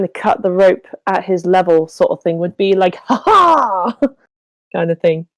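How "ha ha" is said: triumphant, theatrical villain laugh; higher, showy exclaimed laugh; dramatic "ha ha". Acted. Player voicing the doctor cutting a rope, dramatic laugh. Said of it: "he'd want to be very dramatic".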